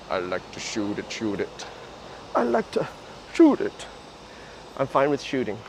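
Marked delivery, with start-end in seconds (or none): 0.1-1.7 lowered mock Adrian. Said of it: "Adrian's like". 2.3-3.9 high mock Adrian. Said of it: "Adrian's like".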